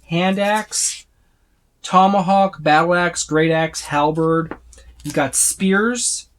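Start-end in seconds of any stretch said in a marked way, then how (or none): none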